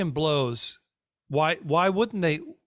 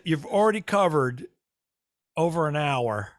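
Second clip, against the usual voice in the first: normal talking voice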